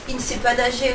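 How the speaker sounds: plain reading voice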